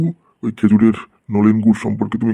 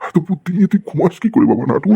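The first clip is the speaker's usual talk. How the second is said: mock amazement; 8 semitones higher than usual. Teacher mock-marvels at student's cleverness.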